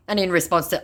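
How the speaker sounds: usual speaking voice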